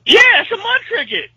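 high, loud laughing insistence. Real, not acted. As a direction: shout it back through a laugh. Insisting loudly over confusion.

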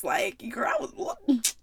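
breathy whispered disbelief; whispered aside. Real, not acted. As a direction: drop to a stunned whisper to a friend. Aside to listener, stunned.

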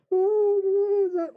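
put-on high childish giggle; creepy high girlish laugh; little girl giggle. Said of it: "And then I hear".

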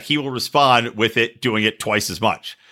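normal talking voice

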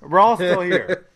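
loud laughing reassurance. Real, not acted. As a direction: laugh it out loudly. Demonstrating a pause survived.

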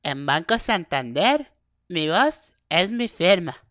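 slow, loud recorded bank slogan; bank phone voice. Retold or imitated. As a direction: read it like an automated bank line. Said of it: "estás en la línea diciendo".

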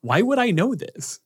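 high-pitched, flustered mock protest; Cassie, exasperated. Cassie protesting to the team, high mock exasperation. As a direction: squeak it out, baffled and defensive. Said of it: "and Cassie goes".

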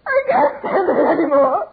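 loud, high, crying shout at him; a wife crying out at the stranger writing names; terrified cry. Acted. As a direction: scream out in terror. Woman confronts strange old man.

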